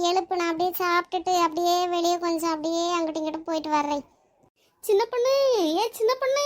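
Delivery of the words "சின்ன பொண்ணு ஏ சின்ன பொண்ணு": high, rising neighbourly call. Acted. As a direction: call for her from outside, voice pitched up. Neighbour calls her out.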